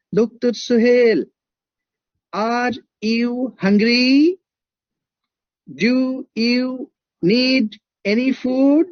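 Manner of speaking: slow, every word spaced with gaps; slow clear Thai English. Retold or imitated. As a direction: imitate the Thai lady, each word separate and clear. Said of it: "This is the best way of English I have ever heard".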